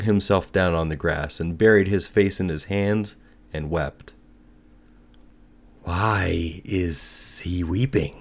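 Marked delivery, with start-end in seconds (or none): 5.8-8.1 slow, drawn-out lizard voice; put-on lizard asking at half pace; little lizard. A narrator's reading. Little lizard asks about the weeping student, slow creature voice. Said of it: "asked a little green lizard".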